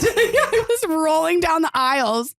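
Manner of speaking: laughing through the words, slow; cracking up mid-sentence. Real, not acted. Laughing while telling dream.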